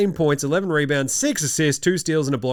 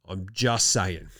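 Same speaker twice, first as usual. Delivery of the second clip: low slow mutter; 7 semitones lower, 6 dB quieter, slower than usual. Acted. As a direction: drop it low and slow, deadpan. Repeated line, drops low and slow.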